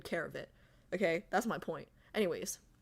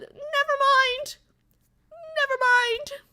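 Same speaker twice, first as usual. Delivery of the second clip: squeaky refusal; 5 dB louder than usual. Pretend. Streamer squeaks a refusal to play horror. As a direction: squeal it out in mock panic.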